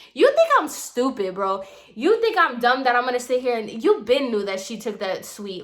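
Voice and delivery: getting mad. Real, not acted. Storyteller worked up at the landlady's excuse, getting mad. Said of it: "you see, I'm getting mad".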